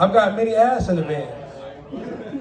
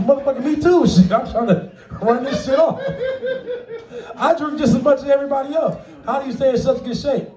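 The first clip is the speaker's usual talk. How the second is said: high, excited neighbor voice; pitched-up mock cheer; imitating neighbor; 7 semitones higher than usual. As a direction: play the tipsy admiring neighbor. Voicing encouraging drunk neighbor.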